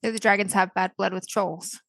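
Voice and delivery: ordinary delivery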